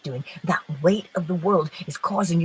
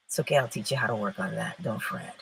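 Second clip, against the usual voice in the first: low, quick, hushed comforting aside; soft reassurance; 8 semitones lower, 7 dB quieter, faster than usual. Real, not acted. Telling listeners not to fret, softly reassuring. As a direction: drop right down and soothe them. Reassuring audience after cellulite quote.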